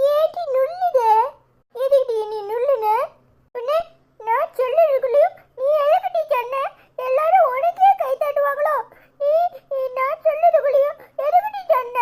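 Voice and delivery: put-on high-pitched squabbling child, indignant; cross child. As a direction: scold a classmate in a high child's voice. Children quarrel after class over an answer.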